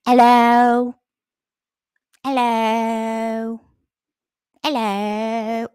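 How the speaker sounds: loud, high, eerie drawn-out hellos; cat yowling hello at the top of the stairs, loud and eerie; psycho cat calling. Retold or imitated. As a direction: call up from the top of the stairs, unsettling. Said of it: "top of the stairs".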